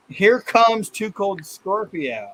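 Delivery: slow, high mock announcement; imitated warning. Imitating the singer warning children about Scorpio, slow mock warning. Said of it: "is she warning the children".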